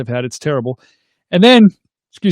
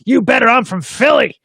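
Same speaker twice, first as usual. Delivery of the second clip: higher, slow put-on threat; yelling woman; 6 semitones higher than usual. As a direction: mimic an aggressive stranger's mock yell. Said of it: "She kept yelling".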